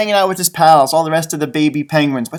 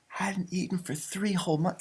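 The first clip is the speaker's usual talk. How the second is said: much softer, "three" stressed; hushed repeat; 14 dB quieter than usual. Shown as a demonstration. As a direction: murmur "three whole months" in awe. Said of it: "And he hadn't eaten for three whole".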